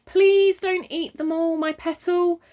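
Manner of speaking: high, loud begging; pleading. A narrator's reading. Father pleads with Myrtle over the custard creams. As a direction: plead sweetly with the child. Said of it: "pleaded Father".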